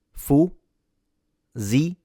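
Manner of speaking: Fu-zi-li split into slow separate syllables; very slow syllable breakdown of Fu-zi-li. Shown as a demonstration. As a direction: spell it out slowly, Fu-zi-li. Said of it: "correct pronunciation of the word".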